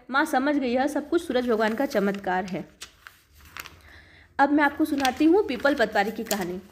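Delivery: plain reading voice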